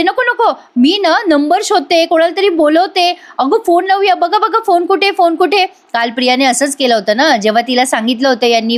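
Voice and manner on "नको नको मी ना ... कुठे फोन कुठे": much higher, hammed-up alarm; fake panic. [name] mimics Priya's fake drama, high mock panic. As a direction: pretend to panic, mocking her. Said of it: "तसंच नाटक करतात".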